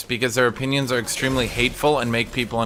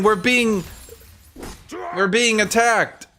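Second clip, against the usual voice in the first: mock whiny complaint; 7 semitones higher, slower than usual. Mocking conservatives' complaint.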